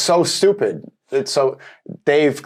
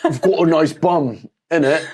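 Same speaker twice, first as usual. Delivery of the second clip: hammed-up London chat-up; mock London accent; 4 semitones higher than usual. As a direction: put on a cheeky London slang accent. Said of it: "Why do you say it with an accent?".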